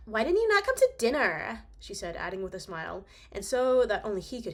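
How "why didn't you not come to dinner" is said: high, teasing snooty voice; mean girl voice. Acted. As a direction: tease him like a mean girl. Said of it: "mean girl voice".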